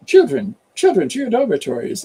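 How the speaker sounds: high, loud schoolmistress calling out; Professor McGonagall. Acted. Professor ordering children to dormitories, in a high, loud voice. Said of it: "plays Professor McGonagall".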